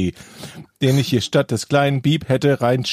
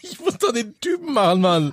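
high defensive voice; 9 semitones higher than usual. Real, not acted. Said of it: "wie sprichst du denn".

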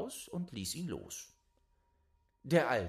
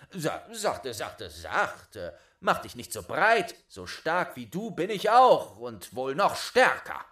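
much louder, defiant bragging; bold boast; 10 dB louder than usual. Acted. Boy boasts to the giant, much louder. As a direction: puff up and boast.

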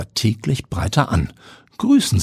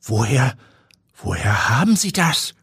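shaken; 5 semitones higher than usual. A narrator's reading. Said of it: "Mühsam fasst er sich".

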